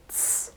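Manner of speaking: a hissed TS with no voicing; unvoiced ts. Shown as a demonstration. Teacher showing the first pronunciation, unvoiced ts. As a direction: say TS with no vibration in the throat. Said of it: "no voicing".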